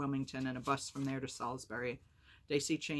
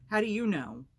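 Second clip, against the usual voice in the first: loud, raised-pitch demand; angry challenge; 7 semitones higher, 10 dB louder than usual. A narrator's reading. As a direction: challenge it hotly, voice jumping up. Sammy angrily challenges Dicey on phone.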